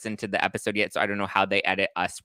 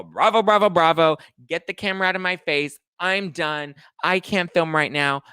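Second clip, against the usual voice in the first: high, loud diva storm-off; hammed-up diva refusing to film; mock diva tantrum; 8 semitones higher, 5 dB louder than usual. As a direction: sneer it like a diva quitting the scene. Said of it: "All diva".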